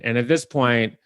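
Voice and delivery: ordinary talk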